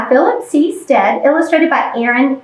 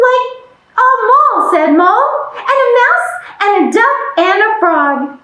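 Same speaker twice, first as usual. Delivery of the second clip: eager; 7 semitones higher, faster than usual. Acted. Friends add characters eagerly.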